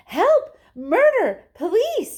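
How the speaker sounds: high, loud hysterical screams; mother screaming in alarm. Acted. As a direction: scream for the police. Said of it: "screamed Missus Gloop".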